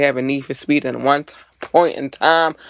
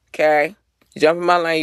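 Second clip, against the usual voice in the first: normal talking voice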